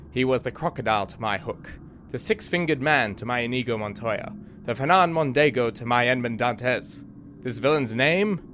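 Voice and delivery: usual speaking voice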